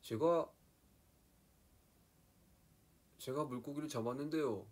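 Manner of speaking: sing-song it high in Gangwon dialect. Shown as a demonstration. Showing fans Gangwon speech, high Gangwon accent. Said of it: "강원도는 약간".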